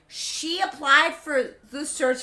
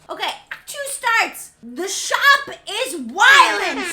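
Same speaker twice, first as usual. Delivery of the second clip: excited; 8 semitones higher, 12 dB louder than usual. Real, not acted. Hyped announcing the act.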